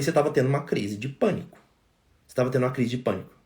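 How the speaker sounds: low, soft, matter-of-fact reassurance; calm explanation. Retold or imitated. Doctor tells calmed patient what happened, quiet and calm.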